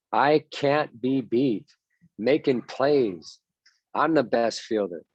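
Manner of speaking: "I can't be beat" said very slowly and deliberately; slow self-talk. Shown as a demonstration. Coach shows players how to talk to themselves, slow deliberate mantra. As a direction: drag out each word like a private mantra. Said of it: "it's how you talk to yourself".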